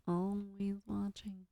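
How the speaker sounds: creepy hushed quote. Acted. Streamer quoting a film at Automod, slow hush.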